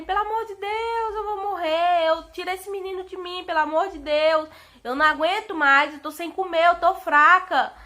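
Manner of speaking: mock pleading yell of someone in agony; grito de dor imitado. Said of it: "e que eu gritei mesmo". (imitando um grito de súplica desesperada)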